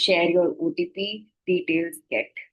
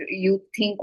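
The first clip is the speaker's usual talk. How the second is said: normal talking voice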